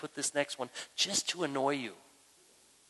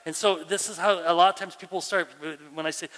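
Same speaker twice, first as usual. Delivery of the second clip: ordinary talk, nothing marked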